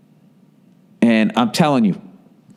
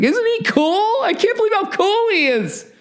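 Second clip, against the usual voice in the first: fawning falsetto. Retold or imitated. Mocking fawning press.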